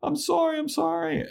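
very high, squeaky pretend crying; mock tearful pleading. Willow crying as the others walk away, squeaky mock-tearful pleading. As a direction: imitate a tearful beg in falsetto. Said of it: "Willow starts crying".

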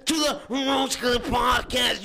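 high, slow hammed-up wrestler promo; wrestling promo. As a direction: cut a wild wrestling promo, slobbering. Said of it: "sign out with our best wrestling impression".

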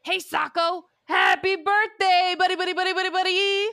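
loud, high, stretched birthday yell; yelling into phone. Retold or imitated. Spencer yells birthday wishes into the phone, loud and high. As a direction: holler it down the phone. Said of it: "Spencer yells out".

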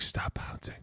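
whispered retold warning; hushed correction. Spotters interrupting lifter mid-reps, hushed correction.

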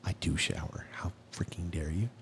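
hushed, voiceless mock outrage; mock-offended whisper. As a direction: whisper it as if affronted. Mock hurt at teasing about showering.

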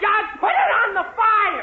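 loud, high shout across the kitchen; shouting at Jughead to aim the hose; frantic shout. Acted. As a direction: yell at him over the chaos. Yelling at Jug with hose.

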